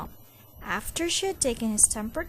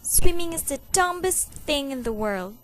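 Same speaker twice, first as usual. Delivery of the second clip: angry; 5 semitones higher, 5 dB louder than usual. A narrator's reading.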